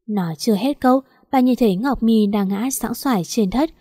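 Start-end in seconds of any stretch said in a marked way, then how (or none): none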